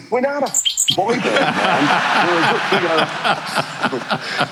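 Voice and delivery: high, slow, wounded pop star; slow offended drawl. Retold or imitated. Pop star corrects the host about his band, high and slow. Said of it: "He goes".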